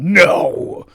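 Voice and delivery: mock-violent shout bursting on the last word; comically overdone bellowed no. Shown as a demonstration. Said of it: "surprised at his own violence".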